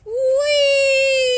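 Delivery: high, loud, gleeful shriek; a thrilled kid whooping in free fall; joyful yell. Acted. Dennis falling off the tower, high gleeful yell. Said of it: "Dennis yelled as he fell".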